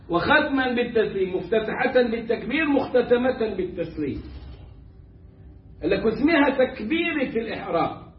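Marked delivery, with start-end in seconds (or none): none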